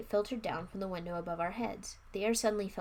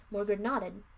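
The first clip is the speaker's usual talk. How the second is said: usual speaking voice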